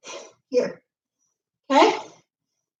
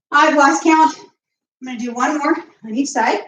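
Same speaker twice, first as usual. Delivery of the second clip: normal talking voice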